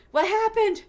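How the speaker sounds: high, loud bewildered cry; dazed confusion. Retold or imitated. Retelling waking up after the sake, high confused cry. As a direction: sound like you just woke up lost. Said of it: "I'm like".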